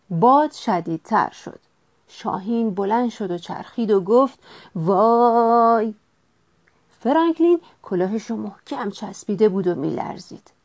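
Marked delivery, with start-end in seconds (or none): 4.8-5.9 long, held-out wow; drawn-out cry. A narrator's reading. Hawk spinning in the strong wind, drawn-out cry. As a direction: stretch the exclamation out in one held call.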